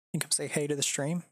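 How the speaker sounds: light coaxing voice to the dog, spoken aloud; voiced coaxing. Real, not acted. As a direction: call the dog over gently. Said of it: "repeated as whisper".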